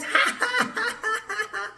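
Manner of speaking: long, high acted cackle of ridicule; high staccato fake laugh, jeering at him; put-on peals of scornful laughter; mocking laughter. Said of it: "And they laughed and laughed at him".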